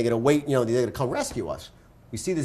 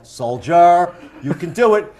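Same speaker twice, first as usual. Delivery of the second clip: mock rallying; 2 semitones higher, 8 dB louder, slower than usual. Rallying friend in retold story.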